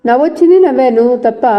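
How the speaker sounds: unbothered, a little defiant; fearless. A narrator's reading. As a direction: answer back without a trace of fear. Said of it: "బెదిరి లేకుండా అన్నాడు".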